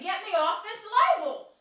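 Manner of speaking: hammed-up screamed demand; imitated screaming. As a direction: holler it like a trapped little girl. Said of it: "she's screaming".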